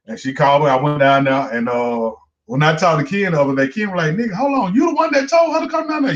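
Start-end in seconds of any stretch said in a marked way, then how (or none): 4.1-6.1 high, fast incredulous squeal; mock surprise. Ken asking if he sent her, high mock shocked voice. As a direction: act out Ken's disbelief.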